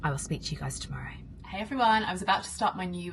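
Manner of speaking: soft fast whisper. Real, not acted. Signing off to viewers, soft whisper. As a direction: hush it like a secret. Whispered sign-off and new greeting.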